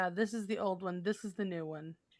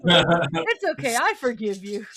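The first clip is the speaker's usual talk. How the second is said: mock forgiveness; 8 dB louder than usual.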